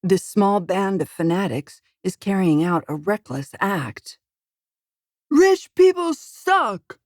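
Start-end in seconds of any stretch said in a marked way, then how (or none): 5.3-7.0 very high, loud, slow jeering yell. A narrator's reading. Rioter battering a rich man's car, yelling high and loud. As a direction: bellow the insult at the car. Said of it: "a man yells".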